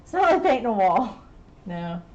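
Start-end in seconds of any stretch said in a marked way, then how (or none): none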